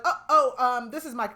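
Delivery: high, loud startled "oh, oh"; wife caught off guard, blurting in alarm; flustered. Retold or imitated. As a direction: caught out, stammering for an excuse. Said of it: "And she's like".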